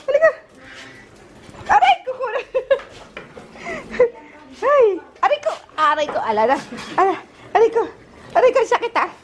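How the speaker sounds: high-pitched ouches; quick high yelps of "ow"; yelping in pain. Real, not acted. Rushing out and saying it hurts, yelping. Hurrying out, crying ouch repeatedly.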